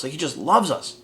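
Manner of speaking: ordinary talk, nothing marked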